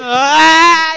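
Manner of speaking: loud high yelp; shout. Real, not acted. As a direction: burst out with a yell. Sudden outburst in chat.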